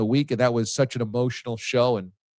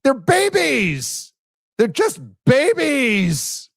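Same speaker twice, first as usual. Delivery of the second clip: high, slow stretched-out wail; hammed-up high scream of despair; mock screaming; 14 semitones higher, 4 dB louder, much slower than usual. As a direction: wail it like a grieving fan. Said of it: "shaking his head and screaming".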